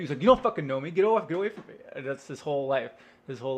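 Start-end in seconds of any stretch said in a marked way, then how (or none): 0.2-1.7 loud, agitated push-back; acted angry rejection. Loner to a clinging admirer, angry rejection. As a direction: drive them off, voice raised.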